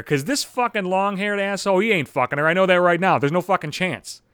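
ordinary talk, nothing marked

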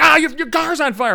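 high, loud mock panic; panicked cry. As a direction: shriek it like a cartoon character. Neighbor panicking at the fire.

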